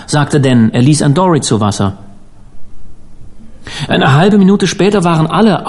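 ordinary delivery

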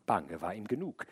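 plain reading voice

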